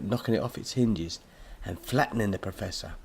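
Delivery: plain reading voice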